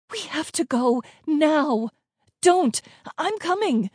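high, pressing, agitated cries; urgent shouting. A narrator's reading. Sister dragging the child away from the ball, urgent shouting. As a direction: plead loudly to get moving. Siblings struggle under gunfire.

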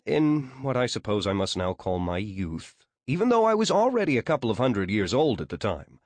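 plain reading voice

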